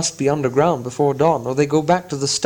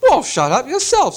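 troll retort; 11 semitones higher than usual. Acted. Tom snapping at William, high troll retort. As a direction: throw it back at him. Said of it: "said Tom".

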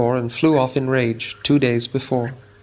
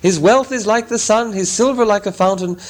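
excited crying out; 9 semitones higher than usual. Acted. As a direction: cry the old rhyme out in triumph. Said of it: "they cried".

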